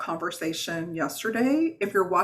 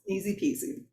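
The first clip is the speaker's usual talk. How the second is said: playful; 8 semitones higher, 4 dB quieter than usual. Real, not acted. Playful aside after instructions.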